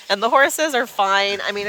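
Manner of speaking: normal talking voice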